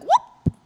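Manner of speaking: sudden high yelp; high mock whoop. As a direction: squeak it out high. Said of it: "they're like".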